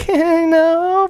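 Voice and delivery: high, put-on girl's voice saying no; mock denial. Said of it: "they might be like".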